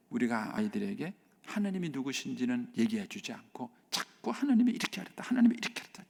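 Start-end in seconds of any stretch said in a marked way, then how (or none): 4.3-6.0 nagging parent. Retold or imitated. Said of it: "이렇게 자꾸 얘기하는".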